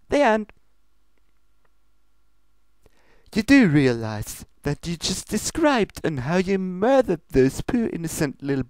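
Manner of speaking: high, bubbly, fast pony voice; cheerful Pinkie Pie. Acted. Pinkie cheerfully explaining her bunny recipe, bubbly and quick. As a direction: chirp it out like a giddy party pony.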